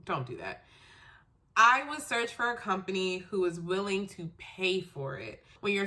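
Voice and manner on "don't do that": usual speaking voice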